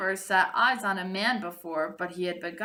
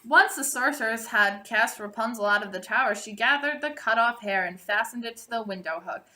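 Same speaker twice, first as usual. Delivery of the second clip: usual speaking voice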